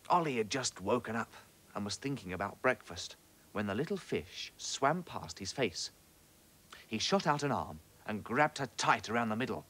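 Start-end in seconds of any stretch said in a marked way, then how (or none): none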